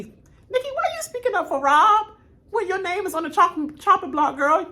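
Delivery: high, loud mock indignation; rapid-fire high-pitched questioning; indignant challenge. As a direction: call her out in a high, incredulous voice. Acts out indignant challenge to Nicki.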